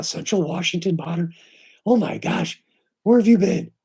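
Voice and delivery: light up at a long-lost friend; excited greeting. Real, not acted. Greeting a returning viewer.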